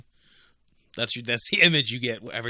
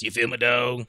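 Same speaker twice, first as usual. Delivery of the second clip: louder, punchy "dog?" at the end; loud catchphrase; 3 semitones higher, 8 dB louder than usual. Pretend. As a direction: shout the catchphrase at the fans. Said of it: "leave in the comments".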